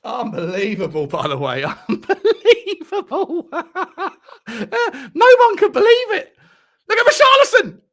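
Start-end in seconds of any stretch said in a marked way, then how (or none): 1.9-3.6 slow, high, laughing unbelievable; laughing disbelief. Real, not acted. Fan laughing at an unbelievable moment, laughing. 5.1-7.8 high, loud, thrilled shout; excited yell. Real, not acted. Fan cheering Richarlison on screen, excited yell.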